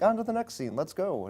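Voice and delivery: breezy, impatient "let's go"; rushing everyone along; mock hurried voice. Mocking a rushed film's pacing.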